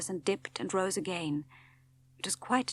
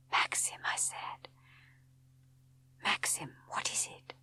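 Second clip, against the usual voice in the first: breathless hushed fear; wife alarmed at the glow on the horizon; frightened whisper; 7 dB quieter than usual. A narrator's reading. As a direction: whisper his name in dread. Wife frightened, seeing his face.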